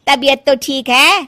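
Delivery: loud, scornful gossiping neighbour; loud mocking scorn. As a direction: jeer loudly at every daughter-in-law. Mamta mocks Sushila, scorns daughters-in-law. (ताना मारती हुई ज़ोरदार आवाज़)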